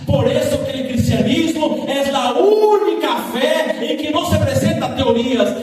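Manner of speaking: loud, ringing sermon delivery with rising intensity; full-force preaching. Acted. Pastor preaching to the church, loud and fervent. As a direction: preach it out to the congregation.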